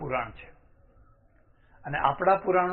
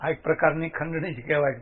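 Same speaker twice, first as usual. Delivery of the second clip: normal talking voice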